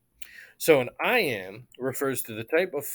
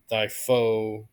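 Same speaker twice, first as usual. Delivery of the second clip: slow "thy foe", FOE stressed; slow stress demo. Shown as a demonstration. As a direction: drop "thy", lean on "foe". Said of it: "pronouns ... will be unstressed".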